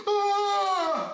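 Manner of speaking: high-pitched hammed-up wail; mock scream. Son against the wall, mock scream at dad. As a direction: imitate a tearful scream. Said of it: "I'm screaming".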